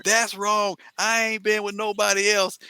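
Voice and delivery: high mock denial; indignant guest. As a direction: mimic a guest protesting innocence. Mimicking guest denying DNA results.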